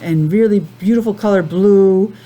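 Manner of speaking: ordinary delivery, nothing marked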